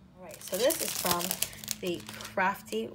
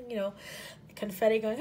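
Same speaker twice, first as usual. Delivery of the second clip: normal talking voice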